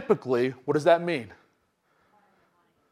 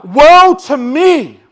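slow loud anguished cry; 8 semitones higher, 12 dB louder, much slower than usual. Acted. Isaiah crying out before God, loud and slow. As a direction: wail it out, stretched. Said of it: "I cry".

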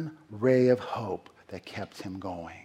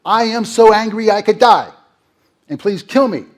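loud, high angry outburst; furious Jonah; 8 semitones higher, 11 dB louder than usual. Retold or imitated. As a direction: blurt it out at the end of your rope. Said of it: "he's like".

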